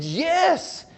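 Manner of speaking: bright, high, eager yes; an eager young man says yes. Retold or imitated. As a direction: jump at the offer. Eager boy offered engine seat.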